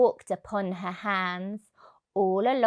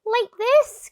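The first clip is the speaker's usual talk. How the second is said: high, loud eager call; cheerful acrobat; 12 semitones higher, 8 dB louder than usual. A narrator's reading. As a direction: show off brightly like a circus performer. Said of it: "cried Annie the acrobat".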